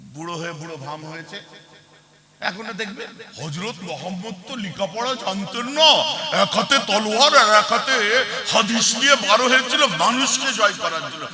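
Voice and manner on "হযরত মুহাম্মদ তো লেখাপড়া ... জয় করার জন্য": lightly mimicked orator; mock a politician making a speech; exaggerated speechmaking lilt; political leader's style. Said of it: "তার স্টাইলে".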